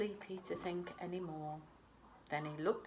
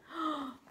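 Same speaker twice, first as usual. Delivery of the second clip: quick higher gasp of wonder; surprised gasp; 10 semitones higher than usual. Real, not acted. Reader marvels at the moon for the children, gasping. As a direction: gasp at how far it is.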